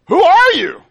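outraged crowd demanding an answer; indignant question. Acted. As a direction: fling the question back at him.

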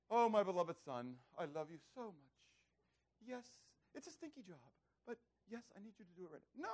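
hammed-up loving patience; sweetly patient parent. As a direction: coo it gently, as to a beloved child. Mock sweet, patient parent reply.

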